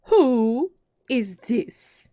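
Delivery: slow, loud imperious demand; severe. A narrator's reading. Queen questioning the Knave, severe. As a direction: draw it out sternly, like a displeased monarch. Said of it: "the Queen said severely".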